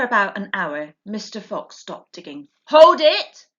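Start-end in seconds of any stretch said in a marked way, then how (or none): 2.6-3.5 loud high halt; sharp loud call. A narrator's reading. Mr Fox halting the digging family, sharp call. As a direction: stop everyone dead. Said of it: "he said".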